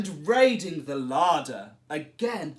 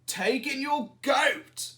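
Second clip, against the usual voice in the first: put-on wise old man; 8 semitones higher than usual. A narrator's reading. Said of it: "said the wise old man".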